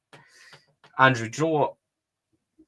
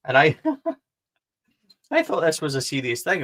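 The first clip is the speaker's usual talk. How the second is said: laughing; 5 semitones higher than usual. Real, not acted. Laughing while retelling the chat scare.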